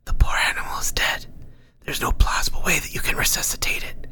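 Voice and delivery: breathy unvoiced whisper, close and secret. Unseen Nordstrom whispering advice to Ank. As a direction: murmur it like a hidden advisor in his head. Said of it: "Nordstrom growled".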